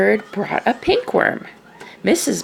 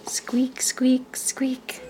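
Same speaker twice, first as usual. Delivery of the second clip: squeaks; 6 semitones higher, 6 dB quieter, much slower than usual. A narrator's reading. Egg squeaking sound.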